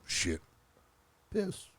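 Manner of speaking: low slow narrator voice; deep soft movie narration; Morgan Freeman impression. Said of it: "Did you do a Morgan Freeman impression".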